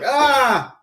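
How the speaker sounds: high, loud imitated "ah" scream; stunned demon shriek. Retelling the demon seeing the tattoo, stunned shriek. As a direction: burst out with a mock demon cry. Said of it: "demon sees it and is like".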